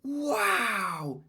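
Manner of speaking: high hushed amazement; awed wow. Acted. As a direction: sound impressed. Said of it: "said Jonathan".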